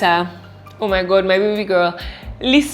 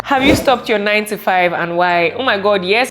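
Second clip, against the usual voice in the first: ordinary talk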